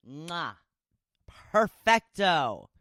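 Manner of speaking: hammed-up Italian chef kiss, perfecto; playful fake Italian accent; mock Italian. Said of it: "That's my Italian for you".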